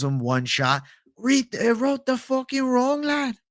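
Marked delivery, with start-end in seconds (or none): 1.2-3.4 much higher, slowed, a muddled put-on Irish accent; botched Irish accent. Streamer reading chat, trying a failed Irish accent. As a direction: attempt a Conor-style Irish brogue and miss it. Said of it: "I don't know whose accent that is".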